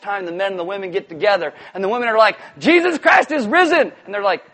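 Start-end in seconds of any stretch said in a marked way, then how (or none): 2.6-3.9 high, loud, excited cry; jubilant proclamation. Acted. Women announcing resurrection to men, exuberant shout. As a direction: proclaim it with full joy.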